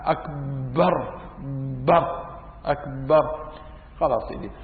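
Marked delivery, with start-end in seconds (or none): 0.0-3.5 "أكبر" said slowly with the ba doubled and stressed; doubled ba in akbar. Shown as a demonstration. Teacher demonstrates the wrong doubling in akbar, slow and stressed. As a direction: lean on the "بر" as if it were doubled, slowly. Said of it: "وعدم تشديدها".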